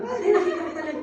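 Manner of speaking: high laughing imitation of the Filipina; a chuckling caricature of the coworker; laughing mock of her.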